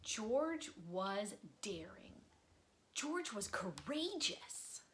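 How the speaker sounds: expressive read-aloud storytelling; lively children's book narrator; story narration. A narrator's reading. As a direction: read it like bedtime story time for kids. Reading a picture book aloud.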